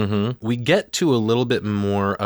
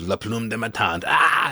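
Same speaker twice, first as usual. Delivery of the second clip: gleeful taunting; 3 semitones higher than usual. Retold or imitated. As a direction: tease in French, then cry out with relish. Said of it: "she's loving it".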